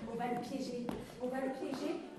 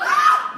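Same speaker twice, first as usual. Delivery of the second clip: shrill scream; 17 dB louder than usual. Acted. Cri de panique.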